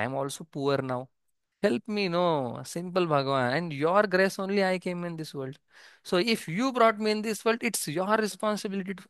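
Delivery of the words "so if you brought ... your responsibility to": a touch of entitled demand. Shown as a demonstration. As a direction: press God as if he owes you. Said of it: "he is not talking in that tone".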